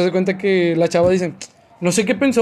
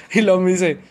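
ordinary talk

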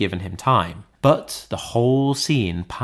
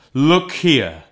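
higher, louder urgent ghost; urgent exclamation; 3 semitones higher, 4 dB louder than usual. A narrator's reading. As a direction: look here, pressed hard on Scrooge. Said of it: "exclaimed the ghost".

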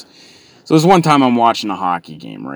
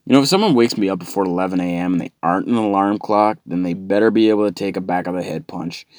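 normal talking voice

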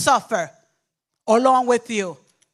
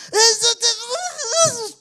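put-on high, slow babbling toddler; high slow toddler whine; acting the toddler; 5 dB louder, much slower than usual. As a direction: stretch out the frustrated baby noise. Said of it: "I act the part".

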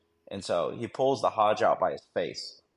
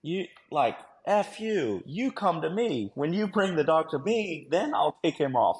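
high, heated mock shouting match; retold cursing; 9 semitones higher, 4 dB louder than usual. Handler cursing at the bitten man, retold in a raised voice. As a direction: imitate yelling orders back at an opponent. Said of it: "I just start cursing at him in English".

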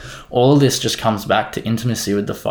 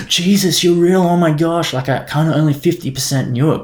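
gasping, much higher, louder amazed cry; amazed disbelief; 7 semitones higher, 3 dB louder than usual. Pretend. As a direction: act stunned to meet Jesus at last.

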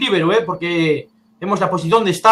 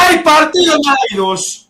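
excited commentary; 6 semitones higher than usual. Real, not acted. Goal call after Celta score.

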